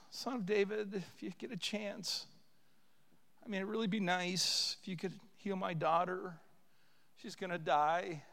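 soft, offhand, polite request; deliberately understated begging; mock casual plea. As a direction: ask as if it hardly matters. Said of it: "Do you think that mother said".

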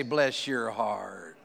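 low, slow Southern bless your heart. Retold or imitated. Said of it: "they say this all the time".

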